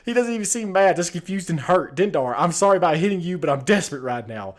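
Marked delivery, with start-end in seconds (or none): 2.0-4.5 loud, raised pleading demands; desperate shouting. Acted. Player shouting at Dendar about lost savings, desperate. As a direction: shout at him, desperate for the money.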